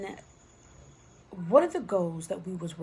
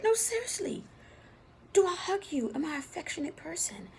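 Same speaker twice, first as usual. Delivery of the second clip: high insistent plea; 11 semitones higher than usual. Retold or imitated. Pressing brother for honest answer.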